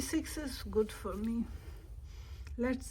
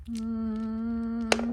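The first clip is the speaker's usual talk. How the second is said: normal talking voice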